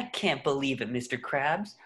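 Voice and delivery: low, subdued disbelief; dejected. Acted. SpongeBob confides in Mister Krabs about his friend, low and dejected. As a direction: sink low with hurt.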